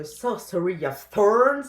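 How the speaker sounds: hammed-up English accent on the book title; mock English accent. Shown as a demonstration. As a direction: put on a proudly clumsy English accent for the title. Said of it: "toujours avec ce merveilleux accent anglais".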